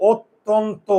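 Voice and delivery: "অত্যন্ত" drawn out slowly, ot-ton-to; slow pronunciation demo. Shown as a demonstration. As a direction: stretch "অত্যন্ত" with every vowel as ও. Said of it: "মুখের চোয়াল খুলে না". ("অত্যন্ত" ধীরে, ও-কার দিয়ে)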